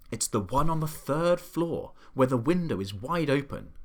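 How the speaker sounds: plain reading voice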